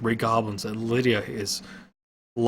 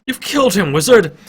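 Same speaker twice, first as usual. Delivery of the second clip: angry; 10 semitones higher, 11 dB louder than usual. A narrator's reading. Lydia accuses wizard.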